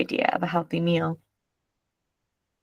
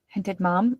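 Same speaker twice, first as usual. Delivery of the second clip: ordinary delivery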